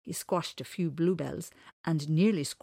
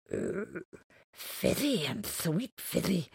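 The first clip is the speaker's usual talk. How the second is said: thoughtful creature; slower than usual. Acted. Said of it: "said Mister Whiscockily thoughtfully".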